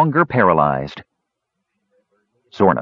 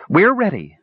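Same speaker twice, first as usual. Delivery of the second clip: higher, defiant declaration; boldly; 7 semitones higher than usual. A narrator's reading. Meadow facing Gorath beside her lover, bold. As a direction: stand tall and say it. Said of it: "said Meadow boldly".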